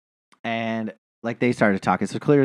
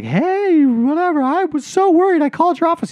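high, gushing mock girlfriend voice; imitating a breathless girlfriend, pitched far up; gushing girlfriend imitation. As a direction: gush it way up high like an overdone sweetheart. Imitating the girl greeting coach.

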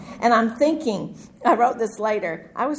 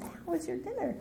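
much softer, forced-calm sweetness; 10 semitones higher, 13 dB quieter than usual. Retold or imitated. Wife greeting husband home with a softly calm question. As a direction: pretend everything's fine, softly. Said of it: "kissed him really calmly and said".

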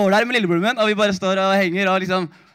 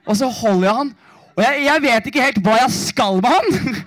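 usual speaking voice

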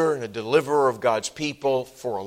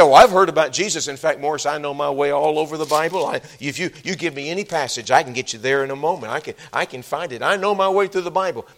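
louder, quick, boastful Bible know-it-all; proud churchgoer; 3 semitones higher, 6 dB louder, faster than usual. Retold or imitated. Preacher mimicking a self-assured listener, lightly boastful. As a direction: brag a little, quickly. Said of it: "You say".

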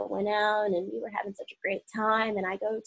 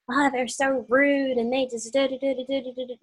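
mock whiny complaint; 6 semitones higher, 4 dB louder than usual. Mocking a rude mother-in-law.

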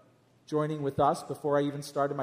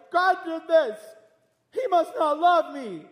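high, loud despairing wail; despairing cry; 11 semitones higher, 7 dB louder than usual. Retold or imitated. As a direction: cry out like all is lost. Doomsday Chicken Little complaint.

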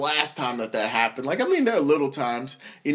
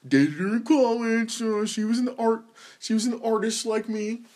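mock your own college romance, pitched up; self-mocking; 5 semitones higher than usual. About the artist he dated in college, self-mocking high voice.

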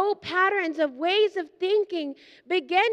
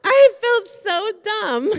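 slow, loud and higher, laughing at herself; laughing embarrassment; 4 semitones higher, 9 dB louder, slower than usual. Real, not acted. Preacher laughing to the congregation at her own foolishness, loud and slow. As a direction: spill it out through embarrassed laughter.

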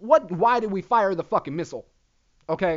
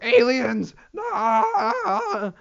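high, loud mock-panic shriek; loud high mock hysteria; panicked internet people; 7 semitones higher, 4 dB louder, much slower than usual. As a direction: shriek about aliens like a hysterical crowd. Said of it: "that's how everybody on the internet sounds".

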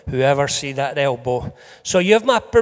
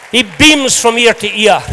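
normal talking voice

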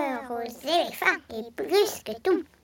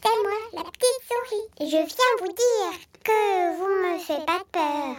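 squeaky little mouse; 6 semitones higher than usual. Acted. Child voices little mouse.

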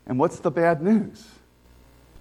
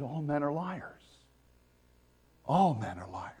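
God's solemn statement; 4 semitones lower, 7 dB quieter than usual. Retold or imitated. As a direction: state it calmly and gravely as God. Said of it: "He said".

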